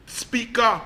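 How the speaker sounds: usual speaking voice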